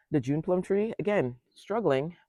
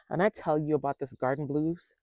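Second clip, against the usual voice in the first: ordinary talk, nothing marked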